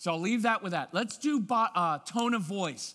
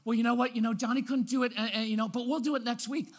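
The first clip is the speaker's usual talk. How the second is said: high, hesitant, over-bright appeasing; fussy placating parent; 4 semitones higher, faster than usual. Shown as a demonstration. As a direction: soothe a child with nervous, singsong excuses. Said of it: "that's a lot better than".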